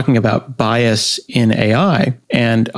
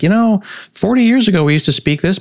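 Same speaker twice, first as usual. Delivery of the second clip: higher-pitched imagined reminiscing; wistful reminiscer; 8 semitones higher than usual. Retold or imitated. Imagined speaker recalls their lost language, lightly wistful. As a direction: lightly voice someone looking back. Voicing a future nostalgic speaker.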